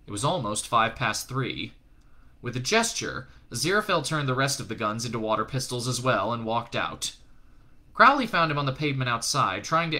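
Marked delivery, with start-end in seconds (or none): none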